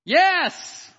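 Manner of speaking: loud, high, emphatic yes; blurted false yes. Retold or imitated. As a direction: blurt out the lie at full volume. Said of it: "you know what I said".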